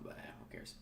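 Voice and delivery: breathy dismissive whisper; whispered aside. Real, not acted. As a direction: throw it away under your breath.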